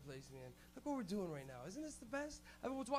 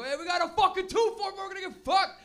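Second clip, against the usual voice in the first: higher, louder party shout; pumped yell; 10 semitones higher, 12 dB louder than usual. Retold or imitated. Campers hyped about their beer, loud excited hollering. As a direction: holler it like beer's here. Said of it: "You go".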